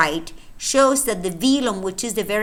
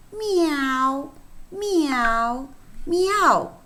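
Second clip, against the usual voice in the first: kitten mewing; 6 semitones higher, much slower than usual. Acted.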